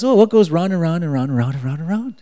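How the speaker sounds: fast, high, loud riddling; loud, breakneck retelling of a riddle; excited riddle. Retold or imitated. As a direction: rattle off the riddle with playful excitement. Second riddle told with mounting excitement.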